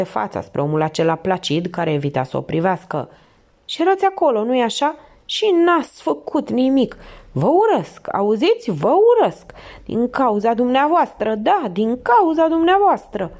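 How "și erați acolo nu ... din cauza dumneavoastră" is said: high-pitched, anguished reproach; hateful accusation. A narrator's reading. Distraught Felicie turning on placid Maigret, accusing bitterly. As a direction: hurl the blame, pitched well up.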